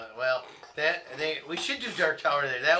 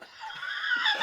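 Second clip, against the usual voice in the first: burst of laughter; 5 dB louder than usual. Real, not acted. Laughing at Rob's one job.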